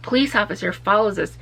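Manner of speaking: ordinary delivery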